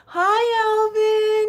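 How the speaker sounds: ordinary talk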